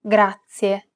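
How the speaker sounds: "Grazie" with a tapped R, GRAH-tsyeh; rolled R. Shown as a demonstration. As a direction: roll the R in "Grazie". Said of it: "flip your tongue up and back".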